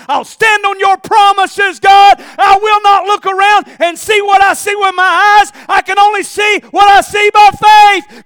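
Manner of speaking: David declaring his faith in a shout; shouted declaration. Acted. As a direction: proclaim it to the heavens.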